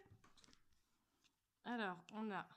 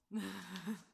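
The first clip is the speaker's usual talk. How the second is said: laugh; 6 dB louder than usual. Real, not acted. Laughing at blindfolded friend.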